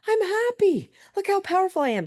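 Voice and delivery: squeaky high, pleased with herself; high gloating voice. Retold or imitated. Teen tells mom she's happy, high smug squeak. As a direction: crow it out in a high little voice. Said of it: "I was like".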